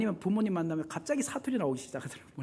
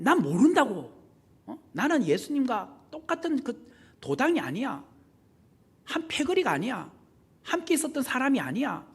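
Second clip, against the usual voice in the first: a raised, insistent denial, higher; Peter denying; 6 semitones higher, 4 dB louder than usual. Retold or imitated. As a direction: push Peter's frightened denial up and out. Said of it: "그러면서 철저하게 내가 예수 믿는다는 것을 감추기". (다급하게 부인하는 베드로)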